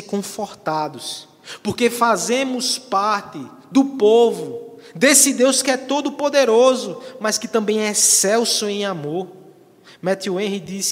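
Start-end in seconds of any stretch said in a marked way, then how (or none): 1.6-9.3 raised, driving pulpit voice; fervent preaching. Acted. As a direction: preach with rising force to the last phrase.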